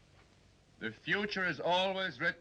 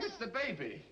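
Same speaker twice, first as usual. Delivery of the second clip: usual speaking voice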